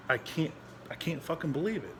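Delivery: ordinary talk, nothing marked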